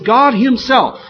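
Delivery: "God Himself" high, loud and slow; emphatic. Acted. Preacher quoting God's statement to the congregation, emphatic. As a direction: punch out "God Himself" with weight. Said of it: "He's being very emphatic".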